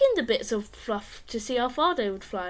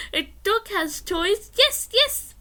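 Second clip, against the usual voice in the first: excited hope; 9 semitones higher, 4 dB louder, slower than usual. Acted. Excited hoping it's a dog.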